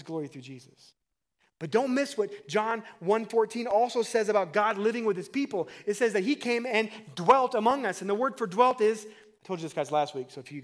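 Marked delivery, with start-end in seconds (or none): 1.6-9.1 loud, raised-pitch pulpit delivery; impassioned preaching. Real, not acted. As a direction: preach it out with force. Preaches at full force.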